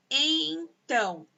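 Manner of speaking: "então" stretched as EN, TÃO; slow, divided "então"; syllable by syllable. Shown as a demonstration. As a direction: sound out "então" in two long beats. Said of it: "tem dois sons".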